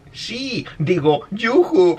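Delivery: high, slow, drawn-out take; imitación burlona. Acted. Slow replay of the subtitle joke. (burlón, agudo y alargado)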